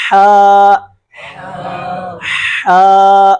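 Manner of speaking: ہا drawn out from the middle of the throat; Arabic haa as a model; throaty ha. Shown as a demonstration. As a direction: breathe a long ha from mid-throat. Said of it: "یہ والا پڑھ رہا ہوں پہلے میں".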